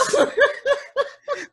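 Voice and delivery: high breathy laugh. Real, not acted. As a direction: laugh helplessly. Laughs at team names.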